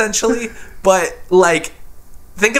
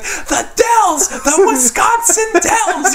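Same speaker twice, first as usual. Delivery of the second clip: imitating a yell; much slower than usual. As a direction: scream it like Daniel Stern losing it. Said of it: "starts yelling at him".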